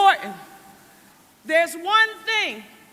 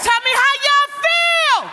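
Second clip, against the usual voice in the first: "Tell me how y'all feel" shouted high and loud; full-voice rally call; 6 semitones higher, 4 dB louder than usual. Shown as a demonstration. Mayor firing up the rally crowd after practice, shouting. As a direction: belt it out to the whole crowd. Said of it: "unless we practice one time".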